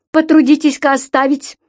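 high, ringing indignant order; crisp, high-pitched demand. Acted. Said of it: "звеняще отчеканил".

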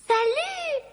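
very high, drawn-out hello; high-pitched holler of greeting; calling out. A narrator's reading. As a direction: call down into a cellar, sing-song high. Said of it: "cria-t-elle".

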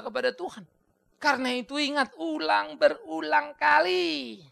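high, loud pulpit exhortation; ringing sermon plea to the congregation; impassioned preaching. Acted. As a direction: preach it forcefully, drawing out the final words. Preaches at full force.

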